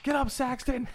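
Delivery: high, excited commentator yell; Corey Graves impression. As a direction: bark it like a ringside commentator. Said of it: "And then Corey Graves".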